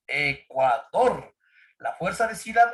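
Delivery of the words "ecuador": "Ecuador" slow and syllable by syllable, landing on "dor"; correct last-syllable stress. Shown as a demonstration. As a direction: stretch out "Ecuador", force of voice on the final "dor". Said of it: "¿Cómo decimos?". ("Ecuador" lento y silabeado, con la fuerza en "dor")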